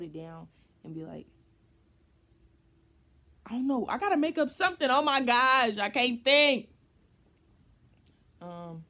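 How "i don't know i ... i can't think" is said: flustered panic. Real, not acted. Panicking over what to text.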